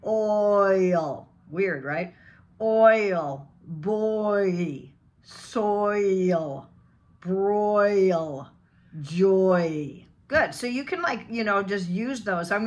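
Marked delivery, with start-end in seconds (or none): 0.0-1.3 "Oil" stretched through O, oo, E; three-sound diphthong. Shown as a demonstration. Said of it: "this is three sounds". 2.6-10.0 put-on stretched O-oo-E glide in Oil, boy, soil; three-sound diphthong. Shown as a demonstration. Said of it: "this is three sounds".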